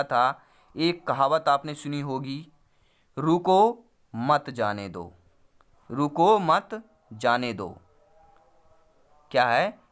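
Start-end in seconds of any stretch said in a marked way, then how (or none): 3.2-5.1 drawn-out रुको, break, then मत जाने दो; pause after रुको. Shown as a demonstration. Teacher recites a childhood saying, pausing after रुको. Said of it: "अगर हमने यहाँ पे विराम चिन्ह लगा दिया". 5.9-7.8 रुको मत together, break, then slow जाने दो; pause after मत. Shown as a demonstration. Teacher shows the saying's other meaning, pausing after मत. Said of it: "विराम चिन्ह लगा दिया तो क्या हो जाएगा".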